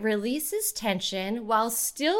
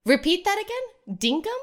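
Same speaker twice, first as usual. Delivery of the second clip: puzzled; 9 semitones higher, 4 dB louder than usual. Acted. Puzzled at odd word.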